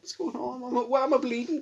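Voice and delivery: put-on high, bewildered child asking; confused kid voice. Friend voicing the injured boy, high and bewildered. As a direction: pitch it up like a baffled little kid.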